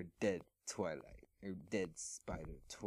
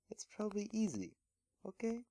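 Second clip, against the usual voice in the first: much higher, fast and eager; high squeaky spider voice; 13 semitones higher, 4 dB louder, faster than usual. Acted. Big Mac Spider coaxing Twilight, high and eager. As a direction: pitch way up like a tiny excited spider.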